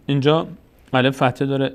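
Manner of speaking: ordinary talk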